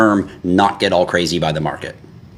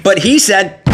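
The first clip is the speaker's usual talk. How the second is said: much higher, louder whine mocking a follower; mocking whiny follower; 12 semitones higher, 5 dB louder, slower than usual. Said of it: "saw me go".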